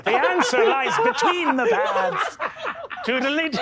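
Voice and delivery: high, slow mock-mystic voice with a laugh; mystical trickster. Comedian teasing the table, hammed-up mystic voice. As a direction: intone it like a sly oracle.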